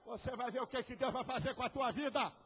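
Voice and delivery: shouted, high sermon climax; preaching at full force. Acted. Preacher roaring to the congregation, full force shout. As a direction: roar the call to obey God.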